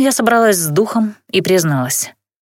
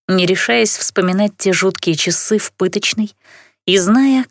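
ordinary delivery

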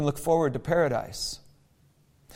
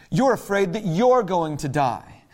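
usual speaking voice

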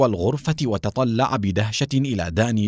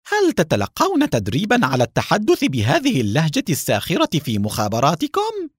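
high, exasperated retort; needled, voice pushed up in anger; seething irritation; 6 semitones higher than usual. A narrator's reading. Haim to the mocking agent he holds, exasperated. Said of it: "وقال بغيظ".

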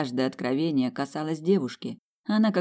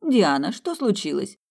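worried mother; 5 semitones higher, 4 dB louder than usual. Acted.

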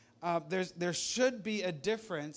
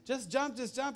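urging dad; 7 semitones higher than usual. Retold or imitated. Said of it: "his dad kept saying".

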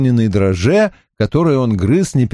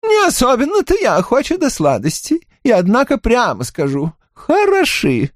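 countess voice; 13 semitones higher than usual. Acted. Mahaut praises the sweets.

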